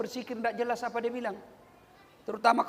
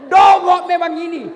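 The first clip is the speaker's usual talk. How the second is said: loud, higher abusive yelling; insulting wife; 6 semitones higher, 8 dB louder than usual. Acted. Wife hurling insults at her husband, loud shouting. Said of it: "mana ini maki-maki, menghina suami".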